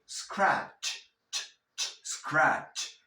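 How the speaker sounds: scratch with a strong final "ch"; pronounced CH. Shown as a demonstration. Teacher insisting on the che, scratch ending in crisp ch. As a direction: isolate the ch, then snap it onto scratch. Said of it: "Esa che tienes que pronunciarla".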